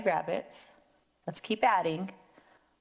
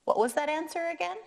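high, quick prompting question; kindergarten teacher voice; 9 semitones higher, much faster than usual. Acted. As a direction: coax the children to answer. Prompting kids for answer.

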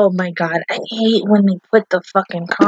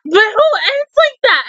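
higher and slow, drawn-out indignation; incredulous complaint; 10 semitones higher than usual. Real, not acted. Viewer protesting the ending to the camera, incredulous. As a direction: stretch it out like it's outrageous.

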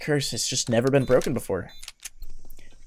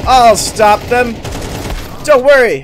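loud, slow, high promise; heroic declaration; 9 semitones higher, 8 dB louder, slower than usual. Acted. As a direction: declare it like an action hero. Heroic repeat.

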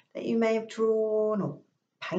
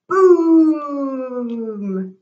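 long, loud booming thunder; big drawn-out thunderclap; 4 semitones higher, 9 dB louder than usual. Acted. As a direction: boom it out like thunder. Crocodile thunders.

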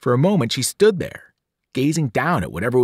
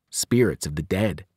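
ordinary delivery, nothing marked

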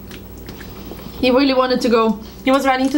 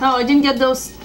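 ordinary delivery

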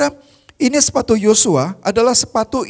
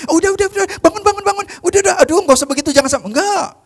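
much higher, mock-fussy hurry-up-get-up voice; fussing commander; 10 semitones higher, faster than usual. Commander wrongly imagined fussing over Joshua, high mock fuss. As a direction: flap over him like an embarrassed host. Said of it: "nggak bilang gini".

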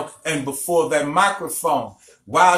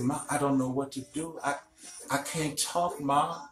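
hushed, much softer near-whisper; a faint, strained whisper to his mother; 2 semitones lower, 11 dB quieter than usual. Shown as a demonstration. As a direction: whisper it like a sick boy who has lost his voice. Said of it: "I was whispering".

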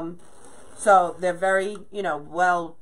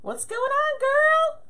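sassy high greeting; 5 dB louder, slower than usual. Real, not acted. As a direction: greet a friend with a sassy squeal. Greeting a viewer in the chat.

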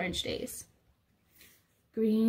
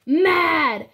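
louder, slow mock growl; mad and loud; 3 semitones higher, 9 dB louder, slower than usual. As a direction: growl it like an angry dinosaur. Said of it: "mad and loud".

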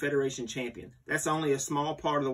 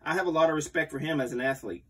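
usual speaking voice

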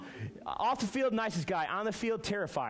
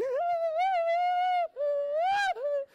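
long squeal, much higher and louder; panicked high squeal; 4 dB louder than usual. Retold or imitated. Telling the youth group what his mind screamed, long high squeal. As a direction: let a long terrified squeal rise out of you. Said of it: "in my mind, I was going".

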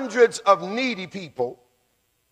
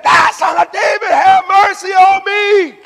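crying out the more; 12 semitones higher, 7 dB louder than usual. Acted. Blind man defies the crowd, crying out even more. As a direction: belt the plea out at the top of your voice. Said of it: "he cried out the more".

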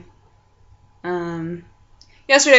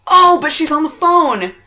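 exclaiming; 9 semitones higher, 10 dB louder than usual. Real, not acted. Mom's line busy, disappointed.

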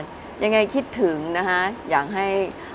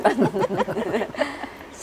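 embarrassed laugh; 3 semitones higher, 4 dB quieter than usual. Real, not acted. Laughs at her own false start.

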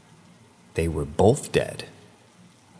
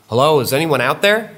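put-on high, loud little-girl call; 8 semitones higher, 6 dB louder than usual. A narrator's reading. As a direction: call into the forest in a raised, girlish voice. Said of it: "a voice breaks out, echoing throughout the forest".

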